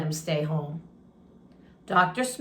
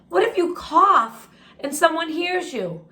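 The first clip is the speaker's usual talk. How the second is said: higher, anxious question; a worried friend asking; worried James; 8 semitones higher, 3 dB louder than usual. Acted. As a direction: fret that he'll be caught.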